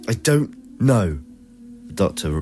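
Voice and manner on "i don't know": higher, louder, slow angry snap. Acted. Doctor snapping at Lily after the quarrel, slow and angry. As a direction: bite the words out slowly. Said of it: "responded angrily".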